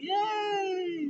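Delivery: high, drawn-out "yay" at modest volume; mock cheer. Friends cheering Austin Theory's return, mock excited cheer. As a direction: imitate a happy group cheering, stretched out high. Voicing a group's happy cheer.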